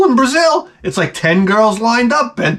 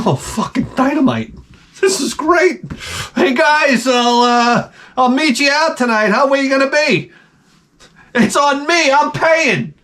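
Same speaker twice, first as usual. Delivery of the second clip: celebrating like a free man; gleeful divorced guy; 4 semitones higher than usual. Acted. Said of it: "you're like".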